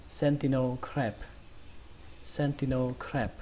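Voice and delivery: sentinel crab as SEN-ti-no kraeb; T without a puff in sentinel, front "ae" in crab; unaspirated T, flat A. Shown as a demonstration. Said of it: "đổi cái âm thì này thành âm tì".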